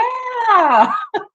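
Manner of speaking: high crying laugh; a big laugh breaking up at the punchline; real laugh. Real, not acted. [name] laughing at teachers fooled by the maintenance guy. Laughing while telling story.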